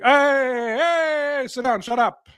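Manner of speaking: high barking barber, no patience; a rude barber ordering the customer around; gruff barber. Retold or imitated. Barber bossing a customer into the chair, barked. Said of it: "it's like".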